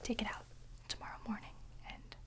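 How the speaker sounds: ordinary talk, nothing marked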